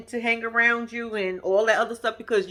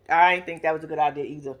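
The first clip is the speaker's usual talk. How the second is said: ordinary talk, nothing marked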